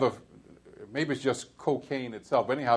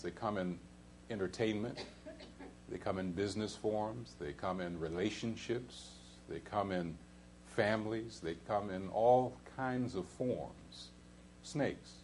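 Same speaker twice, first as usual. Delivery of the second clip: hushed; 4 semitones lower, 11 dB quieter than usual. Real, not acted. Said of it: "listing the serpent's forms softly".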